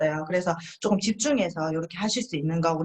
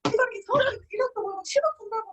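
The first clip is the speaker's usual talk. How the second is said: much higher, laughing over-the-top brag; laughing excited boast; 13 semitones higher than usual. Retold or imitated. Partner bragging about earnings, laughing excitedly. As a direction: gush with laughter about the money. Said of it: "정말 흥분하셔가지고".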